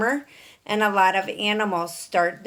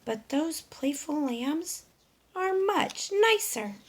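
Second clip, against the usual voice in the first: wistful hen; 8 semitones higher, 3 dB quieter than usual. Acted. Dora's glum thoughts.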